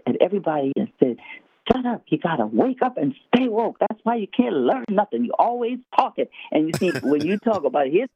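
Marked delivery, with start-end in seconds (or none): 1.7-6.3 retold yell. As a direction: mildly imitate a fed-up telling-off. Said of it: "he sort of just yelled at".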